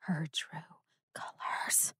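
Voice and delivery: very soft, drawn into a whisper; dramatic whisper. Pretend. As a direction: whisper the teaser's ominous ending. Teasing end of the episode synopsis.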